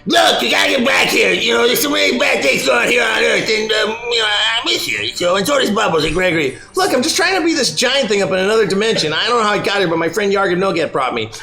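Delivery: voice in Goku's head. Acted. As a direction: chatter on like a worried friend. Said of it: "He's just like".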